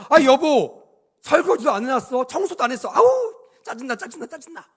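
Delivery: high spousal scolding, fading to a fast mutter; mock annoyed nagging. Spouse nagging about undone dishes and cleaning, mock annoyance. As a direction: act out petty irritation at home. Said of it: "가자마자 막 열받습니다".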